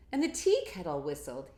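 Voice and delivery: put-on lively read-aloud to kids; picture book reading. A narrator's reading. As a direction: read it big and bouncy for children.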